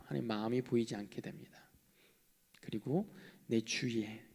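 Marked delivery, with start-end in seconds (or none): 0.1-1.7 hushed whisper. Real, not acted. Pastor whispering the loss to the congregation. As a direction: breathe it out as a whisper.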